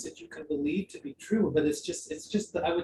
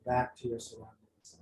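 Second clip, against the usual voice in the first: guided meditation; 4 semitones lower, 4 dB quieter than usual. Acted. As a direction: gently guide them back to the room.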